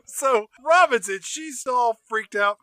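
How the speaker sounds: ordinary talk, nothing marked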